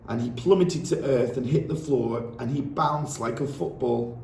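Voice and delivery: plain reading voice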